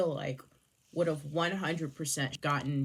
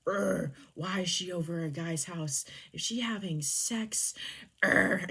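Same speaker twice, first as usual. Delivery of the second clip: exaggerated jealous growling and griping; mock pissed-off; 3 semitones higher than usual. Jealous man complaining that she is over at a guy's house, mock pissed-off. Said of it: "he gets absolutely pissed".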